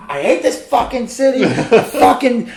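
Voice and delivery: loud, high furious rant at the city; mad rant. Retold or imitated. Father yelling about the city after soda explodes, loud and furious. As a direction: vent at full volume, pitch way up. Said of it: "I was like, so mad".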